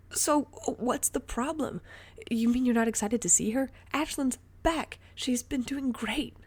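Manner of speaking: high, loud eager questioning; excited pleading. Acted. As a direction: urge him to share the joy. Ray eager, confused.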